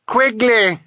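high, loud scream for a lost friend; desperate high call; screamed name. A narrator's reading. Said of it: "she screamed".

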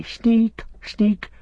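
sneak sneak squeaked very high and mocking; mocking quote. Gollum mimicking the hobbits' insult, shrill mockery. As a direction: parody the hobbits in a shrill taunt. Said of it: "and they say".

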